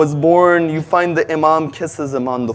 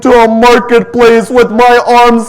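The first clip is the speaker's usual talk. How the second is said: grieving sister; 6 semitones higher, 7 dB louder than usual. Acted. Zainab's grieving words to Abbas.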